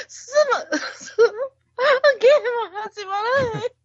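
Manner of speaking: laughing. Real, not acted. Can't stop laughing.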